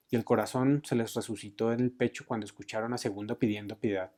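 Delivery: ordinary delivery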